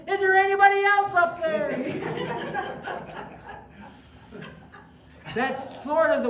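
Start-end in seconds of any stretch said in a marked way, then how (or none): 0.1-1.7 high, loud desperate call; man on the cliff yells for another helper; panicked punchline. Retold or imitated. 1.7-3.9 high breathy laugh. Real, not acted. Speaker laughs at own joke.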